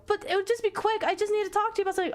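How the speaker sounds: put-on high, rushed girlish begging; urgent plea. Girl begging before his train goes, high and hurried.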